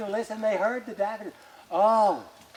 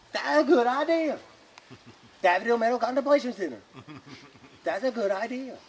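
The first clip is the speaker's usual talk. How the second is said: eager villager; 5 semitones higher, 3 dB louder than usual. Acted.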